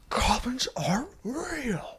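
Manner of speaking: high, slow, whispery shriek; mock strangled scream. Drunk husband attacking wife, mock strangled scream. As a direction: scream it under your breath, drawn out.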